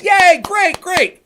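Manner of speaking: high-pitched hammed-up approval; mock cheering. Streamer imitating the opponent's fans, mock cheering. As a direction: cheer along like an easily pleased crowd. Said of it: "says the Pisco audience, the D G Gers".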